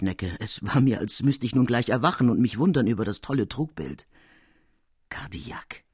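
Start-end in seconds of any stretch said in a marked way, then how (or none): 5.1-5.8 breathless whispered name; stunned whisper. Acted. The apprentice whispers his master's name in horror. Shocked realization of the murderer.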